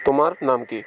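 ordinary delivery

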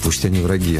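ordinary talk

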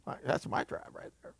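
unvoiced whisper aside. Real, not acted. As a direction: joke quietly to Drew. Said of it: "Drew, that's cool".

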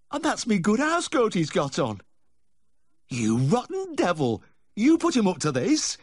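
high-pitched, indignant farmer's wife; shrill-pitched outrage at a rotten prank; outraged scolding. A narrator's reading. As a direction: give the husband a high, furious tongue-lashing. Wife berates husband over his joke.

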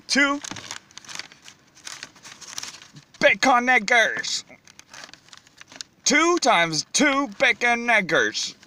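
a lightly voiced fast-food cashier quoting prices; put-on cashier voice. Vlogger plays a cashier figure.

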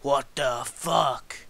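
slow, drawn-out what the fuck; stunned shout. Real, not acted. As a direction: disbelief stretched out. Shocked at game trap.

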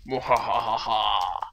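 slow, loud cartoon villain cackle; evil laugh. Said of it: "I'm evil".

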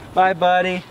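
high, sweet goodbye to a pet. Real, not acted. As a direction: coo a farewell to the little fish. Saying goodbye to released fish.

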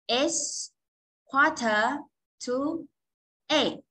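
each word set apart, slow; slow time demo. Shown as a demonstration. Teacher shows students how to say the time slowly. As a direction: model the time slowly and clearly. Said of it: "Mình sẽ nói là".

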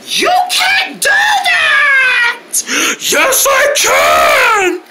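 high, very drawn-out yelling; stretched-out defiant shout; shouted argument. Acted. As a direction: holler it across a distance. Shouted argument across a distance.

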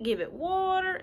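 usual speaking voice

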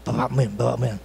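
a hint of a nagging wife, hushed and low; wife correcting. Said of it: "keeps correcting me".

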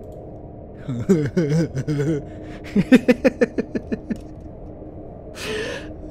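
long, high giggling fit; helpless rising laughter; laughing at oneself. Real, not acted. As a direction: crack up at your own accent. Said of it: "I make myself laugh with that".

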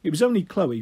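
ordinary delivery

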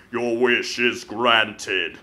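slow and low like an ancient demon summoned; low slow monster voice; deep demon voice. Acted. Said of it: "a deep voice echoed from within the flames".

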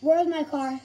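normal talking voice

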